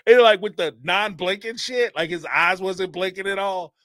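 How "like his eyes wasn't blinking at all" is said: usual speaking voice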